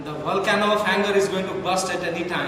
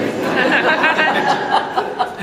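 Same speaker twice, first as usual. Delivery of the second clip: loud high laugh; audience-facing burst of laughter; big laugh; 8 semitones higher, 7 dB louder than usual. Real, not acted. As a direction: laugh hard at his own line. Laugh after punchline.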